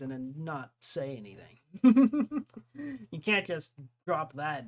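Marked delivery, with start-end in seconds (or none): 1.7-3.1 high, loud chuckling laugh; real laugh. Real, not acted. Streamer teasing a chat member, high loud laugh.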